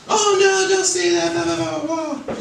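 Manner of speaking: high mock-shocked voice, oh no; mock pearl-clutching churchgoer. Comic mocking scandalized churchgoers, high fussy voice. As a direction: squeal it like a scandalized prude. Mocking uptight religious people.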